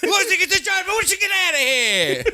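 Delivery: fast, with the last word stretched out; Tony Soprano impression. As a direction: rattle it off like a Jersey mob boss. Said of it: "really fast and then extend out the last thing".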